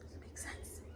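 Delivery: whispered to herself in disbelief. Real, not acted. To herself after the confrontation, whispered. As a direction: breathe it out under the breath.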